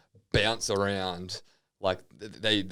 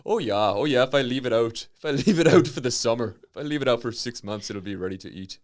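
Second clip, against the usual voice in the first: put-on raised, sing-song Fargo accent; 3 semitones higher, 3 dB louder than usual. Northerner plans to thaw dinner outside, Fargo accent. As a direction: play an upbeat Fargo-style northerner. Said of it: "talk like Francis McDormand from Fargo".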